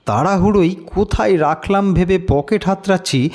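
ordinary talk, nothing marked

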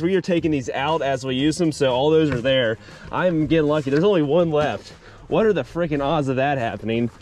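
usual speaking voice

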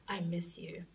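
secret whisper on the phone. Acted. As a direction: breathe it so no one hears. Secret phone call to lover.

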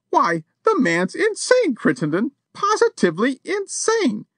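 high-pitched outraged society lady; a lady defying a burglar, high and indignant. A narrator's reading. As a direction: threaten him from injured dignity. Missus Champney voiced.

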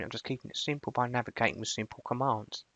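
ordinary delivery

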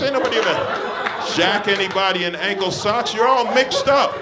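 high, loud comic roast of the man in the crowd; mock outraged rant. Real, not acted. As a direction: yell at him in disbelief, laughing. Ranting at an audience member.

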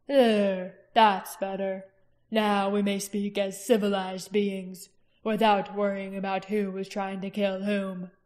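slow, cold, mocking royal menace; king Galbatorix, silky and menacing. A narrator's reading. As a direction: purr the praise of the children like a threat. Narrator voices the king.

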